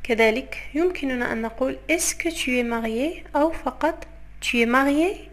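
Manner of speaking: normal talking voice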